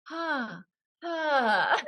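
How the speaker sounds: high, breath-led pretty sighs of pain; mock glamorous "ah" of pain; beauty pain. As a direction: attempt elegant agony, held back. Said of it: "Beauty pain, beauty pain".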